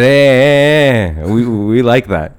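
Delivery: half-hearted English gent savouring the oil; a hint of a British colonial drooling over oil; mock British toff. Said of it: "Jolly, jolly".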